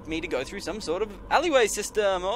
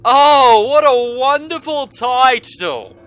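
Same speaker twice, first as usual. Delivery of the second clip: excited; 5 semitones higher, 10 dB louder, slower than usual. Real, not acted. Mock delight at title screen.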